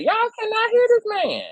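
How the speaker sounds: high-pitched incredulous whine; exasperated squeal. Real, not acted. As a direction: squeak it out in frustration. Repeats complaint, rising to a squeal.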